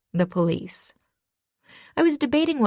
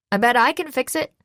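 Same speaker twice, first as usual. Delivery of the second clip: eager, higher and louder offer; enthusiastic; 6 semitones higher, 3 dB louder than usual. A narrator's reading. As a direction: jump in keen to help. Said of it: "George offered enthusiastically".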